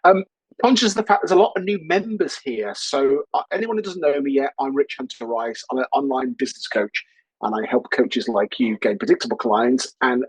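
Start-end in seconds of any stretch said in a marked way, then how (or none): none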